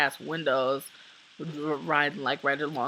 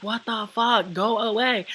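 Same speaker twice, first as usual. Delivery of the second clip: higher-pitched mock yell, not full volume; retold yelling; 6 semitones higher than usual. Walker telling a following driver to leave, mock screaming. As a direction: imitate yelling someone off, held back. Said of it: "like, yelling, like, screaming".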